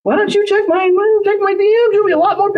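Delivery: very high-pitched mock goading; hammed-up high sarcastic challenge; joking taunt. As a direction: egg them on in a squeaky, exaggerated voice. Jokingly goads an angry person.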